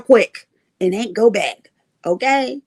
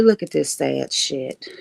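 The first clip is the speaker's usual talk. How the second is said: disdain; 9 semitones lower, 5 dB quieter than usual. Retold or imitated. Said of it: "Just pitiful".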